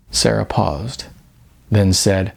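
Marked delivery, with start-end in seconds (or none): none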